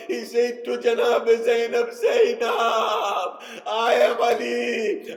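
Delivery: loud, impassioned lament, rising to cries; preaching at full force. Acted. Preacher at the climax of a majlis, loud and grieving. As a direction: drive the climax home with grief.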